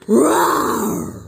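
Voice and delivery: breathy imitated lion roar. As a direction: roar like a pretend lion for the toddler. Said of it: "The lion goes".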